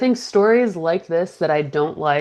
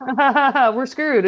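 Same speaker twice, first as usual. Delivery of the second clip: mock nervous laugh; 7 semitones higher than usual. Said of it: "Chuck's like".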